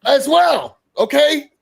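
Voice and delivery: high-pitched insistent comeback; acted arguing. Host voices Kay deflecting in the quarrel, insistent comeback.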